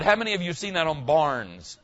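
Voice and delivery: normal talking voice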